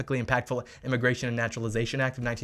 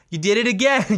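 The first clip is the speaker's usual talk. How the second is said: taunting; 7 semitones higher, 7 dB louder than usual. Real, not acted. Taunting repeat.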